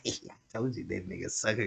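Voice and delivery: hushed gloating aside; low aside. Retold or imitated. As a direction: murmur it low to someone nearby. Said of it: "she looking at him like".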